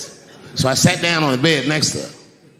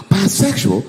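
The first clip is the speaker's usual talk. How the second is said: mock horror after a sharp gasp; gasp, then a raised, scandalized question; horrified gasp; 7 semitones higher, 3 dB louder than usual. Reading the paper about his attacker, mock horrified. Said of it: "I was horrified".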